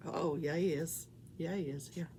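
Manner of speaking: ordinary talk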